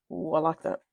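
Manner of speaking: usual speaking voice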